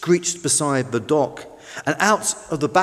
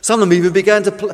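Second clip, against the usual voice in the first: ordinary delivery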